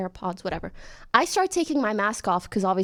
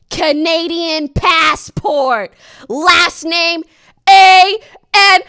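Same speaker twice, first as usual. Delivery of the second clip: slow, loud, stiff official voice; mock bureaucrat reading a passport; stern agent; 7 semitones higher, 13 dB louder, much slower than usual. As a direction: drag out the name like a bored clerk.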